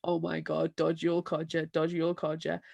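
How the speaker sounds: under-the-breath wariness; a hint of hushed panic; muttered mock alarm. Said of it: "it's like".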